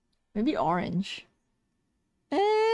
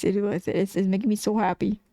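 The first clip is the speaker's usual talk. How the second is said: ordinary talk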